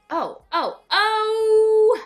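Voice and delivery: rising to a loud high "oh!"; startled shout of remembering; sudden remembering. Real, not acted. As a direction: jolt as if it just came back to you.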